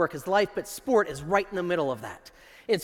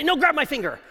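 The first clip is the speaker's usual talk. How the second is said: playful insistence; 7 semitones higher, 3 dB louder than usual. Retold or imitated. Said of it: "and I'll say".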